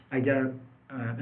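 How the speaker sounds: normal talking voice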